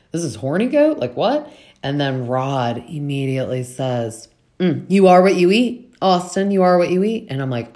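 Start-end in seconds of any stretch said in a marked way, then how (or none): 0.1-1.5 rising higher, disbelieving "horny goat"; incredulous. Retold or imitated. Austin hearing the dish is horny goat, rising in disbelief. 4.6-7.3 higher, louder sing-song taunt; teasing jab. Retold or imitated. Rod teasing Austin across the dinner table, louder sing-song taunt.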